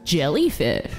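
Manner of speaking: normal talking voice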